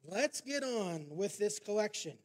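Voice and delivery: impatient shout. Retold or imitated. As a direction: yell at the auctioneer to move on. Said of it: "a man shouted".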